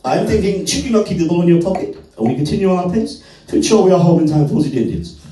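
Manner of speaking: a hint of a pompous German Shepherd explaining fetch to his owner; posh intellectual dog. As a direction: lecture like a snooty professor. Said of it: "he's like".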